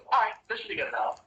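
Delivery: high, quick, pleased patron voice; sly queen of curses. Acted. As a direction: toss it off high and fast like a smug witch queen. Queen pinches cheek, grabs coins.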